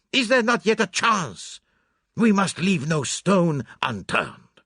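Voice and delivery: put-on furious self-reproach, high, loud and accented; Poirot. A narrator's reading. Poirot berates himself over the lost case, furious outburst. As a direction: rage at yourself, drawing out each exclamation. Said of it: "his anger burst forth freely".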